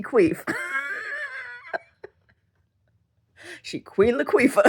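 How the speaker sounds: high laughing squeal; can't hold the laugh. Real, not acted. Cracking up at own joke.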